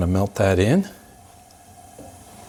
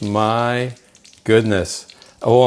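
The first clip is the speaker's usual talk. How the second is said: ordinary talk, nothing marked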